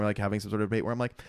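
normal talking voice

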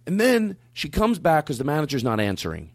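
ordinary talk

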